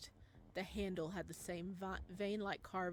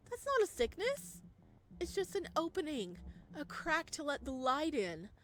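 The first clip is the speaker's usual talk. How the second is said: a lightly voiced, higher, louder persuader; Ryohei pleading; 6 semitones higher, 5 dB louder than usual. Ryohei urging Eden to accept the gift, lightly voiced. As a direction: press her eagerly to accept.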